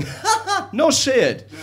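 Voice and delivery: ordinary delivery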